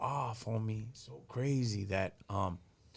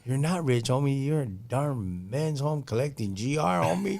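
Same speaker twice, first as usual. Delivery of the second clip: mock scolding; 3 semitones higher, 8 dB louder than usual. Friends mocking Kilo's dream.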